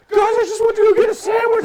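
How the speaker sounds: put-on high, quick, clueless kid voice; innocent Butters voice. Teammate innocently explaining his sandwich break, high Butters-like voice. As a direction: play the oblivious sweet boy. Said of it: "seriously like Butters in South Park".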